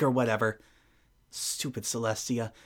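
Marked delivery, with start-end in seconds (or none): none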